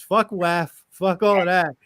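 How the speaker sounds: ordinary talk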